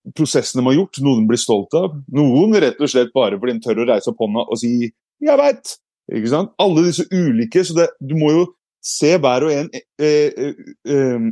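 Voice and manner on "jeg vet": high-pitched eager answer; eager pupil. Acted. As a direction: blurt it out like a keen pupil. Eager child raising hand to answer. (ivrig elev som svarer)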